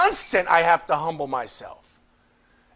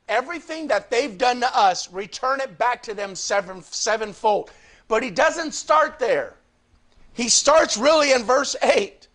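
usual speaking voice

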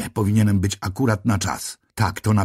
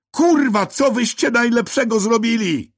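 screaming at full voice. A narrator's reading. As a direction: tear it out of your throat. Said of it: "wydarłem się na cały głos".

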